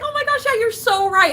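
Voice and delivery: high, sugary yes-man voice; fake sweet agreement. She mocks her own fake agreement online, high and sugary. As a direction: chirp it brightly to smooth things over.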